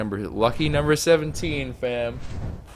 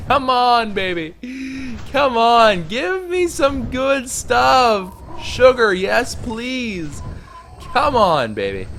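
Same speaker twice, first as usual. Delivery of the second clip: high, loud pleading shouts, slowed down; excited begging; 11 semitones higher, 7 dB louder, slower than usual. Real, not acted. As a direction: beg the loot gods at full volume. Begging the game for loot.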